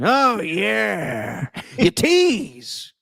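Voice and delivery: high, lecherous villain voice; horny Penguin. Retold or imitated. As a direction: leer it like a lustful movie creature. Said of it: "he's all horny for".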